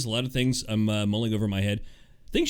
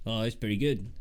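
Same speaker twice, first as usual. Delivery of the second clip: normal talking voice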